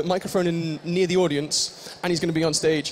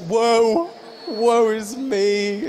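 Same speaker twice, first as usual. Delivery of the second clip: mock woe; 3 semitones higher, much slower than usual. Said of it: "Are you just so sad".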